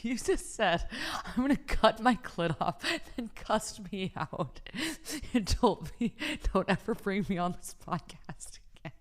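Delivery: helpless laughter squeezing the words; crying with laughter. Real, not acted. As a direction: retell it while losing it laughing. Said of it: "I'm fucking crying".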